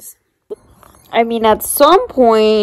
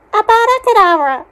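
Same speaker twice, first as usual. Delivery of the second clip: much higher, louder, fast dramatic curse; Voldemort curse; 11 semitones higher, 4 dB louder, faster than usual. Acted. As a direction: cast the killing curse like a hammy villain. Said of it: "Voldemort is about to kill Harry Potter".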